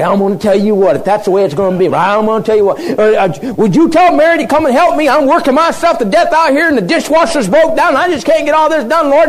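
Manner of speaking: high, loud tirade of an overworked sister; frantic complaining Martha. Acted. Martha demanding the Lord send Mary to help, frantic complaint.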